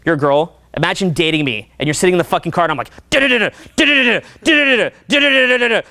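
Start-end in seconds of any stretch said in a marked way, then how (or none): 3.1-5.8 rapid, high-pitched nonstop babble; mock motormouth jabbering; too intense chatter. Imitating himself chattering at a girl in the car, high rapid babble. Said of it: "would find me too intense".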